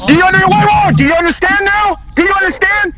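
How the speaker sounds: high, exasperated shouting; real angry outburst; yelling. Real, not acted. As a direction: push the question at them, pitched right up. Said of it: "Stop yelling".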